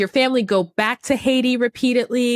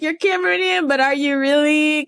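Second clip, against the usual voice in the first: mock doubtful quote; 8 semitones higher than usual. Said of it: "It's like".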